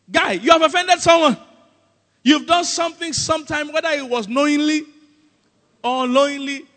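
high, loud preacher's accusation; forceful confrontation. Real, not acted. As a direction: confront them with it, pitched high. Confronting the congregation emphatically.

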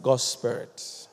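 very soft, slow, low echo; hushed slow response; slow breathed answer. Shown as a demonstration. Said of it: "Open up to what?".

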